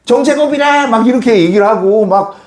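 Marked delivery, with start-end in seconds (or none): 0.1-0.9 high-pitched schoolkid jeering; teasing classmates. Retold or imitated. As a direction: imitate kids chanting a mocking nickname. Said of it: "선생님이 놀리기 시작하니까 애들도 같이".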